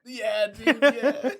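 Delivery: high, loud laughing agreement; real burst of laughter through the words. Real, not acted. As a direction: agree while cracking up. Cracking up at the quoted line.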